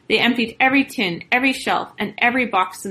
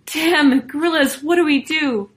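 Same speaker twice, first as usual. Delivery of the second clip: higher, louder alarmed cry; urgent, frightened storybook voice; panicked; 7 semitones higher, 5 dB louder than usual. A narrator's reading. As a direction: call out in a panic for help. Wife panics at armed men arriving.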